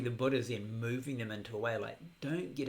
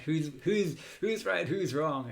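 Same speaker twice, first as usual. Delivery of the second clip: amused, louder and higher mimicry of squabbling; mock arguing; 6 semitones higher, 9 dB louder, slower than usual. Teacher imitating practitioners debating religion in the park, mock arguing. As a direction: play at bickering, voice lifted. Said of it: "argue about religion".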